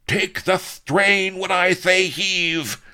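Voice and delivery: high, slow, loud order; roared command. A narrator's reading. Don Lardo orders four horsemen, roaring. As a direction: roar it out, stretching each word. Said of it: "he roared to the four horsemen".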